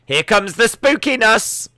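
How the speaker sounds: mock spooky boom, high and loud; hammed-up spooky herald; spooky announcer. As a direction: ham up a spooky entrance.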